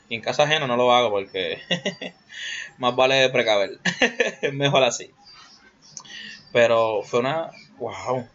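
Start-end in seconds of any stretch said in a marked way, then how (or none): 3.8-4.4 short laugh. Real, not acted. Laughing at own joke.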